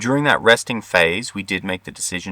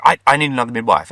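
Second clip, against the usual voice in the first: fast, higher, louder call for help; urgent hurry; 5 semitones higher, 5 dB louder, faster than usual. Retold or imitated. Midwife rushing out to fetch a second midwife, fast and urgent. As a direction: rattle it off while already moving.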